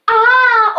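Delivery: loud, high amazed "ah"; shouted audience cheer. Acted. Magician voices the missing audience, shouting an amazed ah. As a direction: imitate a wowed crowd aahing. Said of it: "No one to shout".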